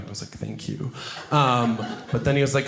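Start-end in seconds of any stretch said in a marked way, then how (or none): 0.3-0.9 whispered thanks. Retold or imitated. Flattered reply to the fan.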